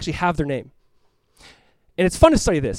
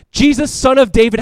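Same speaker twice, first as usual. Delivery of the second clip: loud, high cry for attention; calling out to Jesus; 7 semitones higher, 8 dB louder than usual. Acted. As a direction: shout it as the beggar would. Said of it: "and what does he say?".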